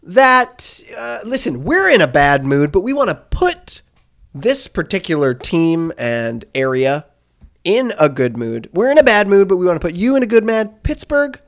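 mock anchor pitching the top story; mock news anchor. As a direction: deliver it like a TV sports anchor. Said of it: "Take it away, [name]".

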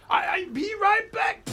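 high, shouted comic Swedish voice; Swedish accent. Acted. Game master as Blue vanishing suddenly, shouted Swedish accent. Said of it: "It's my best Swedish voice".

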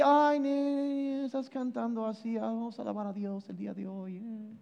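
mock a bored worshipper, soft and limp; hushed, half-hearted parody of listless praise; limp, lukewarm praise. Pastor mocking lukewarm church singing, hushed and limp. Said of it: "aquí no cantamos música aguada".

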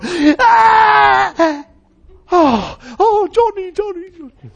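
high, loud mock cries of relief; relieved family cry. Family cries out as the boy's arm comes free, high relief. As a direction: cry out his name with relief. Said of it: "they go".

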